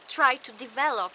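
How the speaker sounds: usual speaking voice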